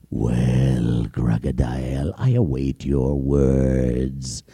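deep, slow menacing lion voice; low, drawn-out royal lion; lion king. Acted. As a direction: growl the test like a hungry king. Lion waits for answer.

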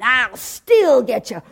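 slow, lightly voiced monster threat; menacing monster. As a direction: drag out the threat like a patient beast. Hairy man threatens from below.

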